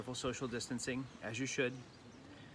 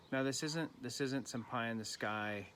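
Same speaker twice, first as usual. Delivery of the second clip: ordinary talk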